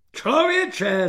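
high, thundering exclamation. A narrator's reading. General rebuking an ignorant soldier, thundering. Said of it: "zařměl".

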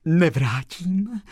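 high, slow, overjoyed gasp of words; breathed out. A narrator's reading. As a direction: let it out in wonder. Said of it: "vydechla slečna Marie".

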